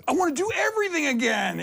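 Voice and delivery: high, gushing burst of enthusiasm; overeager retelling. Retold or imitated. Athlete telling the coach about feeling good, bursting with eagerness. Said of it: "I tell my coach".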